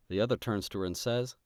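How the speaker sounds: ordinary talk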